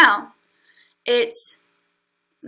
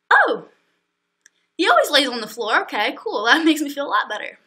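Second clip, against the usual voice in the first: sarcastic relief; 2 semitones higher, 3 dB louder than usual. Retold or imitated. Answering Tyler's mom, sarcastic fake relief. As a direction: act relieved, clearly not.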